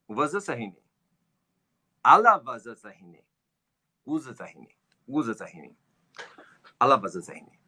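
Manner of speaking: Shanghainese phrases said as a demo; speaking Shanghainese. Shown as a demonstration. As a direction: show off some Shanghainese. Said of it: "I can speak a little bit of Shanghainese".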